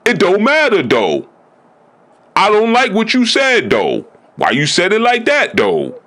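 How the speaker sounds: high, huffy mock voice dismissing the point; petulant comeback. Offended person brushing off the truth, in a high huffy mock voice. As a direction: play the offended one, whining about tone.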